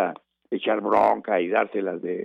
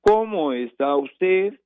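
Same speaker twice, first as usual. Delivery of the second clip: higher, slow, clearly questioning tune; question intonation; 7 semitones higher, slower than usual. Shown as a demonstration. Showing listeners how to voice a question, higher and slower. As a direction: make the question unmistakable with a rising lilt. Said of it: "Ahí hay una interrogación".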